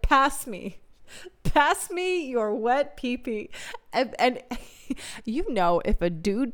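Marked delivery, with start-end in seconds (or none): 0.0-3.5 "Pass" stressed, drawn out and higher; slowly stressing "Pass" as the blunder; mock slow "Pass me". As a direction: spell out "Pass me your wet pee-pee" slowly, higher, leaning on "Pass". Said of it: "She said".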